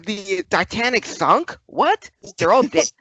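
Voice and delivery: high, squeaky mock disbelief; mock shock. Mocking a latecomer who just tuned in, shocked squeal. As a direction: gasp it out like hearing old news for the first time. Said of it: "he finally turns in and thinks".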